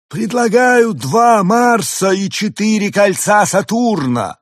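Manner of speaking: high, slow, loud alien; drawn-out grand alien. A narrator's reading. Arthur's alien voice. (высокий медленный голос пришельца)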